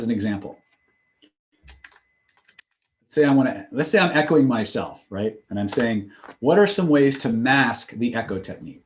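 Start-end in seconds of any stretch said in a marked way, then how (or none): none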